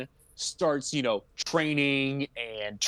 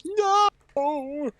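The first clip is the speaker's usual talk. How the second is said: high-pitched, drawn-out wail of NO; mock-horrified high yell; anguished cry; 14 semitones higher, 4 dB louder than usual. He sees the mice cooked, hammed-up anguished cry.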